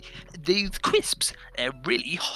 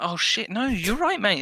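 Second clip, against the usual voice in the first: mock sympathy; 7 semitones higher than usual. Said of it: "And I was like".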